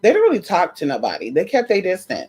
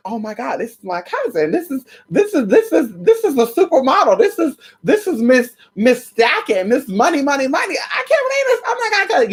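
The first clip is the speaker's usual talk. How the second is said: breathless build from hushed to squealing high; retold giddy excitement, rising to a squeal; starstruck gushing; 7 semitones higher than usual. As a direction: fangirl out, repeating yourself as pitch climbs. Acts out gushing over cousin's arrival.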